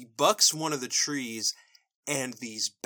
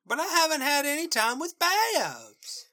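put-on whiny kid sister; 13 semitones higher than usual. Apple Bloom protesting to sister.

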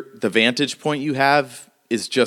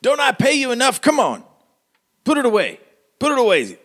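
very high, quick, exasperated character voice; exasperated mayor; faster than usual. Acted. Mayor to his paid guard, high and exasperated. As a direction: scold the hired gun, pitched way up. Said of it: "He says".

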